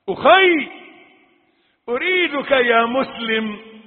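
high, loud call to the believer; impassioned appeal. Real, not acted. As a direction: call out urgently to a brother. Urgent appeal to the listener. (نداء وعظي بصوت عالٍ)

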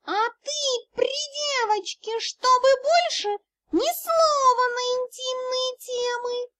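high, slow scolding cat voice; hissing kitten. A narrator's reading. Kitten forbids intimate topics before the girl, high hissing. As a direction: scold him in a squeaky kitten hiss. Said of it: "прошипела кошечка".

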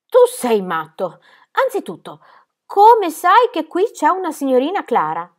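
loud, incredulous challenge rising in pitch; indignant disbelief. A narrator's reading. Sebastiano disbelieving the boy's demand, loud and indignant. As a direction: challenge him loudly, scandalised.

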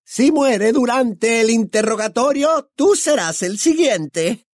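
high, slow, menacing screech; gloating high-pitched menace; threatening witch. A narrator's reading. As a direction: draw the threat out in a shrill witch voice. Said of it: "lo amenazó la bruja".

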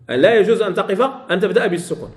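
usual speaking voice

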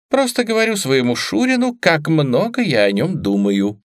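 mock sweet, sarcastic. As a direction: fake compliment, high. Sarcastic Gavin.

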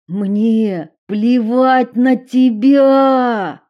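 slow loud defiant yell; shouted. Acted. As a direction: hurl each word. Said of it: "выкрикнул Уилл".

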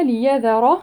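ليذر with the r velarized and full; ليذر with a thick tafkhim r; heavy r in ليذر. Shown as a demonstration. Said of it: "الراء هنا مفخمة".

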